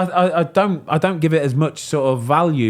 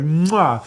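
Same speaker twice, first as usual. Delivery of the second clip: kiss sound; 5 semitones higher than usual. Retold or imitated. Retold kiss from club owner.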